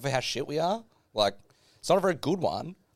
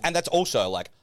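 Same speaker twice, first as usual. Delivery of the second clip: ordinary talk, nothing marked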